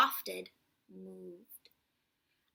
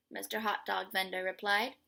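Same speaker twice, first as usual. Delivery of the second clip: ordinary delivery, nothing marked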